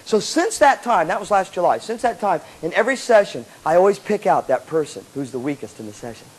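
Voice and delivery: ordinary talk, nothing marked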